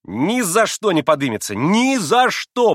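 loud boast, slowing on the repeat; triumphant, proud shout. A narrator's reading. Boy boasting of his dog's obedience, proud shout. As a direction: crow it with victorious pride. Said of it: "победоносно и справедливо гордясь прокричал Коля".

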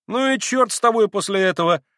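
high, sharp brush-off; curt dismissal. Acted. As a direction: cut him off and turn away. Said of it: "отрезал вдруг Коля".

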